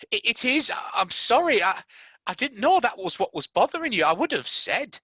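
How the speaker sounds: high stammering sorry; Mary apologising to Colin; flustered apology. Acted. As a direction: stumble over the apology.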